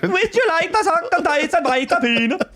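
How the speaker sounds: high singsong Norwegian lilt; mock Norwegian accent. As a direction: Norwegian accent, silly and sung.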